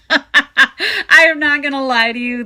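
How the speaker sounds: high loud laughing burst; laughing loudly. Real, not acted.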